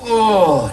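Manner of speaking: high groan of sitting down; effortful groan. Real, not acted. Comic sitting down at that age, high effortful groan. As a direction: groan like ageing joints complaining. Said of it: "I will be making the noise".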